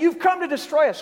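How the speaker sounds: high, loud outcry of the spirits; demonic accusation. Acted. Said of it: "here they are saying".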